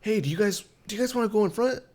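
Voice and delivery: squeaky put-on voice for the stranger; high mock guy voice. Guy in line asking the couple, in a high mock voice. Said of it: "the people in front of me, it was a couple".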